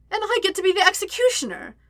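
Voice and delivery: high, gleeful singsong taunt; lilting, gloating sing-song. A narrator's reading. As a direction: sing it out quickly and high, relishing it. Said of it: "she sang".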